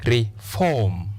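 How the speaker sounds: "reform" split, FORM held long and falling; "reform" as ri... FORM, slow and careful; long second syllable. Shown as a demonstration. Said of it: "long vowel sound o".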